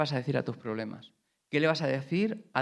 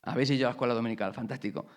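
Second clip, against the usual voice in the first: normal talking voice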